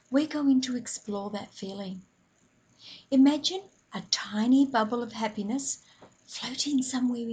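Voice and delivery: calm breathing guide, softly hushed; guided meditation. Acted. Leads a mindful breathing moment.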